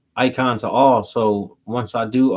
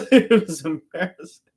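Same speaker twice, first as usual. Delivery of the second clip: laughing; 5 semitones higher, 4 dB louder than usual. Real, not acted. Laughing at his past embarrassment.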